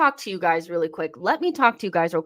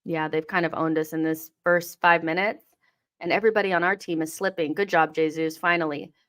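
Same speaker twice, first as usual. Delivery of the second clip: usual speaking voice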